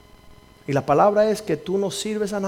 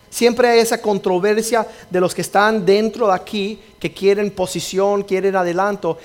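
preaching; 3 semitones higher, 3 dB louder than usual. Acted.

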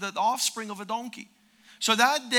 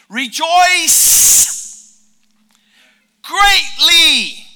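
loud, high ringing proclamation; proclaimed shout; 8 semitones higher, 15 dB louder, much slower than usual. Shown as a demonstration. Preacher booming scripture to the congregation. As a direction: call it out to the whole room.

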